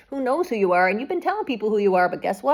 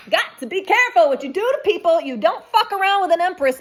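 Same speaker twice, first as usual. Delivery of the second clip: forceful warning; 9 semitones higher, 5 dB louder than usual. Real, not acted. Tarot reader warning wrongdoers.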